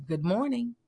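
soft, small re-enacted hello; understated greeting. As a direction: say it softly, as a minimal polite greeting.

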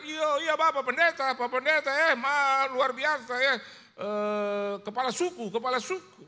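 put-on high, loud, excited Papuan-accented voice; imitated Papuan accent. Papuan locals hail the pastor, excited loud Papuan accent. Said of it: "Saya pernah ke Papua".

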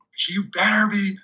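high, slow, emphatic warning; insistent coach. Retold or imitated. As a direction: draw out a mock warning. Acts out coach's stern reply.

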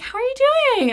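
very high, lilting imitation of mom; mom's friendly greeting. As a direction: imitate a mother's sing-song hello. Acted mom's greeting on phone.

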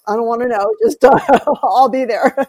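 half-laughing mock horror at the backyard chicken; laughing mock protest. Retelling her reply about the chicken.

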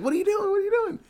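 panicked cry pitched very high; frantic, trying to stop her; mock alarm. Stopping a woman jumping off bridge.

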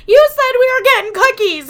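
put-on high, loud whining child voice; whiny crying kid. A hint of the crying kid at the closed cookie shop, high whiny complaint. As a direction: whine it like a disappointed little girl. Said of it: "Her kid's fucking crying".

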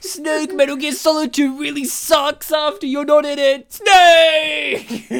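loud, high, hammed-up wail; mock anguished shout. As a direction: cry out for a lost hero, overacting. Mock anguished cries for game hero.

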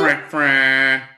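clicky fra fra seatbelt noise; seatbelt click. Shown as a demonstration. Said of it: "the seatbelt sound".

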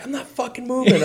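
high put-on furious voice; imitated angry caller. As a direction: snap back as the ranting caller. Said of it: "he basically was screaming at me".

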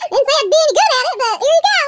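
a giggling, rushed punchline; laughing. Real, not acted. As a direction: rattle it off while cracking up. Laughing through a quoted tweet.

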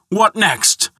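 high-pitched indignant exclamation; angry shout. A narrator's reading. Thor finding a giant in the hall, angry. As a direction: exclaim it in outrage. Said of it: "he shouted".

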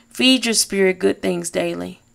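usual speaking voice

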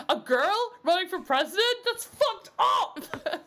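high, loud, hammed-up shock; mock outrage. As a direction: exclaim in scandalised disbelief, half laughing. Mock outrage at crazy teens.

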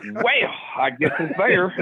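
mock country drawl, stretched out; played-up country accent. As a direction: lean playfully into the country accent. Playing up accent just pointed out.

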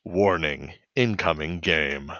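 low, slow system announcement; game warning voice. Retold or imitated. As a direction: imitate a calm automated game alert. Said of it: "the warning incoming game voice".

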